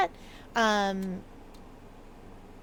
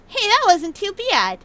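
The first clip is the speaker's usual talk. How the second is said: pleased; 10 semitones higher, 9 dB louder than usual. Real, not acted. Proud of the archaic reading.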